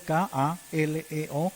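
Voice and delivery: slow letter-by-letter spelling of "K A L E O". Shown as a demonstration. Preacher spelling the Greek word for the church, slowly. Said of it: "con K de kilo".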